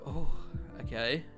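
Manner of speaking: normal talking voice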